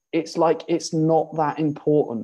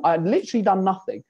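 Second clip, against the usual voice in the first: ordinary talk, nothing marked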